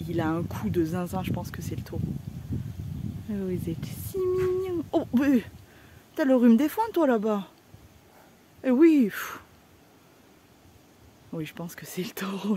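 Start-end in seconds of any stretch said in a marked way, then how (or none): 3.3-7.1 cooing at animals. Real, not acted. Cooing at the cows.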